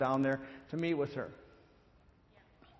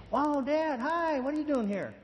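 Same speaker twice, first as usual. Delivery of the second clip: much higher, bright put-on surprise; fake cheerful daughter; 10 semitones higher than usual. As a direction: play it like nothing's wrong. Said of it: "she's acting like nothing happened".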